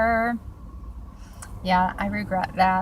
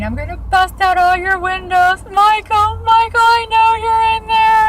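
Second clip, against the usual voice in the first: high, loud mock scream of a jealous girlfriend; imitated screaming; 13 semitones higher, 9 dB louder than usual. Girlfriend screaming for Michael at the car, loud high mock yell. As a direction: yell it out like she's at the car window. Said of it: "She was screaming".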